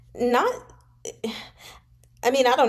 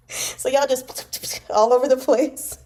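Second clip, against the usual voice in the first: laughing; 4 semitones higher than usual. Real, not acted. Laughing at the spittle.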